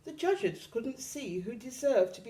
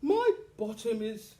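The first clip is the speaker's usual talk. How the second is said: hammed-up hippo; 2 semitones higher, 4 dB louder than usual. A narrator's reading. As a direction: drag it out like a heavy hippo. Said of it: "sighed the hippo".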